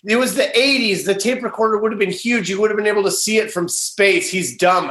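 high, scornful sister voice; mocking older sister. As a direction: sneer at her dumb brother. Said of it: "Sarah's like".

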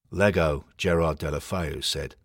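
ordinary delivery, nothing marked

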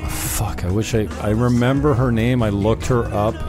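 usual speaking voice

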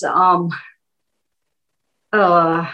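hesitant with long pauses; hesitant filler sounds. Shown as a demonstration. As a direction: stall with um and uh. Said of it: "saying, um, and uh".

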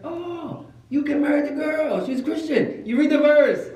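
high-pitched dawning "oh"; excited realization. Retold or imitated. Years later learning he could marry the girl, excited realization.

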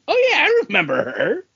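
high, quick oh-yeah voice; sudden high delighted recall; mock surprised recall. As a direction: pop up high like a sudden memory. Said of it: "and it's like".

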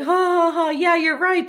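high-pitched put-on ha-ha-ha into agreeing; mock laughing agreement. Recapper voices Charles agreeing with the fighter, mock laughing. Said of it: "Charles is like".

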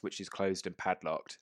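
normal talking voice

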